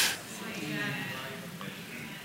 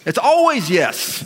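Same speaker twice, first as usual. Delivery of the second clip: "It's always yes" higher and slow with "always" stretched; exultant yes; 7 semitones higher, 3 dB louder, slower than usual. Shown as a demonstration. Preacher proclaiming God's yes, high slow joy.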